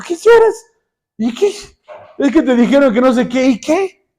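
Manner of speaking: high-pitched, incredulous mock scolding; imitated mother's impatient "so what?"; dismissive scolding. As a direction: brush off the crying friend. Mother scolds her crying friend.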